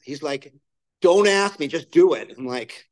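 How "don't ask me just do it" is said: higher, louder blunt command, retold; curt order. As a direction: cut him off, no discussion. Sponsor bluntly ordering newcomer.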